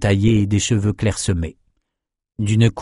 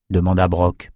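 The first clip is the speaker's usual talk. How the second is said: usual speaking voice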